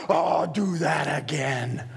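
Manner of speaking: slow, low, drawn-out marvel; savoring delight. Acted. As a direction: stretch it out with deep relish. God delighting in the child.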